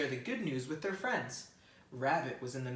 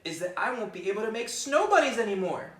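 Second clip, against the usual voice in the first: high, louder, building whiny rabbit; complaining rabbit; 13 semitones higher, 11 dB louder than usual. Acted. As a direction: pout over every lost snow game.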